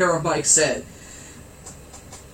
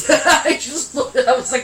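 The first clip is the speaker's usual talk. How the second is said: laughing; 6 semitones higher than usual. Real, not acted. Laughing through retelling.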